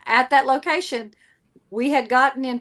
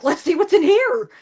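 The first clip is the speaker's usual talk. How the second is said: higher, louder eager call; bold barging in; 6 semitones higher, 5 dB louder than usual. Retold or imitated. Shelly busting through a haunted door, bold. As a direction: burst through the door curious. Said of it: "I'm like".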